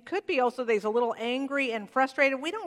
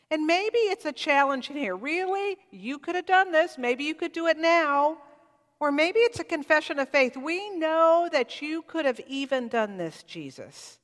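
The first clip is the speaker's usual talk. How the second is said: preaching at full force; 5 semitones higher, 5 dB louder than usual. Acted.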